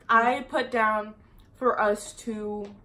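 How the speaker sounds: ordinary delivery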